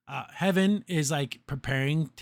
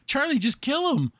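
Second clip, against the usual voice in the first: louder, higher, pleading with Charlie; exasperated outburst; 9 semitones higher, 4 dB louder than usual. Real, not acted. Viewer yelling at Charlie to just kill him, exasperated. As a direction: shout it at the screen in frustration.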